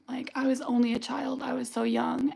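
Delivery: hushed whisper of painful memory. Real, not acted. Remembering the knife scene as a child, whispered. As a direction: drop to a bare whisper.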